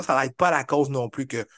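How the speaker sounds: normal talking voice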